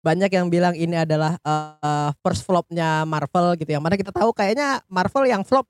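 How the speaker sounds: usual speaking voice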